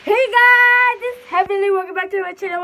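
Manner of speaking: high, loud bubbly hello; excited greeting. Real, not acted. Vlogger greeting her viewers, high and loud.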